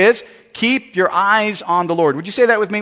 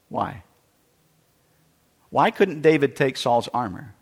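ordinary talk

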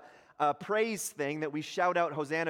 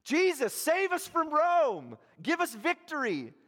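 high, loud begging cheer; pleading crowd cry; 11 semitones higher, 4 dB louder than usual. Acted. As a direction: beg for rescue like a roaring crowd. Crowd voiced at the rally.

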